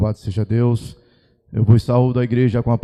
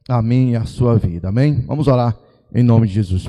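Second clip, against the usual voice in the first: ordinary delivery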